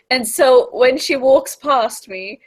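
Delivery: ordinary delivery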